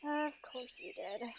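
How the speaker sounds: very soft, low-energy resignation; quiet, deflated reply; resigned mutter. Acted. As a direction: say it under your breath, resigned. Resigned reply to godmother's meddling.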